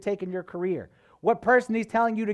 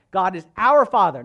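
"our" punched out louder; stress on "our"; 7 dB louder than usual. Shown as a demonstration. Preacher telling a family of believers, stressing "our". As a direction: hit "our" hard, then "Father". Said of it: "I want to stress on our".

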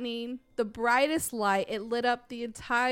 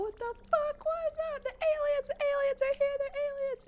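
much higher, frantic squeal of panic; retold panic. Kids panicking about aliens, high frantic squeal. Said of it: "we're like".

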